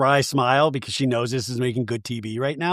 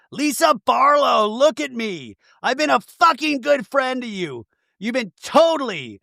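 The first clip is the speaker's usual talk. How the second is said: high, stretched-out scolding imitation; mock furious confrontation; 8 semitones higher, slower than usual. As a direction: confront her in hammed-up outrage. Retold quarrel.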